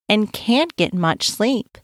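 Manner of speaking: usual speaking voice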